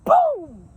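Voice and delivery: loud, high spoken boom; door slam noise. Retold or imitated. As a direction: make the sound of a slamming door. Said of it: "That's the door slamming".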